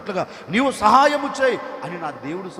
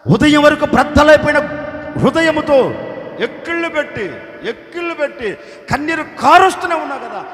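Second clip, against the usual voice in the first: loud, higher pressing questions; teasing questioner; 3 semitones higher, 6 dB louder than usual. Pretend. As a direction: challenge him loudly about his tears. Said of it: "నెహెమియాని అడిగాము అనుకో".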